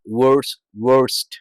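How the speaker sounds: ordinary talk, nothing marked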